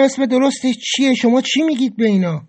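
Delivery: high-pitched, challenging questions; exasperated questioning. A narrator's reading. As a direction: press for an answer, pitch pushed up. (پرسش کلافه و معترض)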